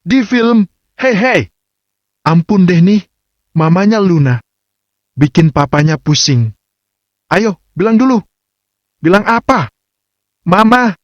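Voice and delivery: put-on light, high teasing young wife; playful wife voice. A narrator's reading. Playful couple banter voices.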